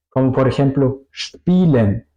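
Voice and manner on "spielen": "spielen" starting with a sh sound, shpielen; SP as SHP. Shown as a demonstration. Teacher giving an example of German SP, "spielen" as shpielen. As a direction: say "spielen" with a hushed S before the P. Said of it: "se pronuncia más como S C H P".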